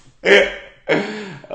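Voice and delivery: loud high laugh; real laughter; cackling laugh. Real, not acted. Laughing at himself.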